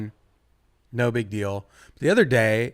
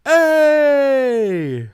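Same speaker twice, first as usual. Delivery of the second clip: loud high shout out a window; calling out; 10 semitones higher, 8 dB louder than usual. Retold or imitated. Comic calling down to a yelling man, loud and high. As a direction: yell hey at the guy in the street. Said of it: "and I go".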